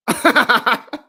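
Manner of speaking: a short loud high laugh. Real, not acted. Streamer laughing at a chat message, loud and high. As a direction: laugh out suddenly.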